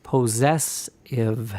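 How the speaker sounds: Possessive said very slowly, syllable by syllable; broken-down word. Shown as a demonstration. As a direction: break Possessive into slow syllables. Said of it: "break that word down".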